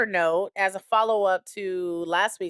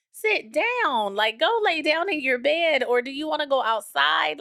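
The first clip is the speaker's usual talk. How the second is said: high-pitched mock nagging; exasperated nagging; 8 semitones higher, 4 dB louder than usual. Owner telling the restless dog to settle, exasperated mock nagging. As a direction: nag in a raised, sing-out pitch.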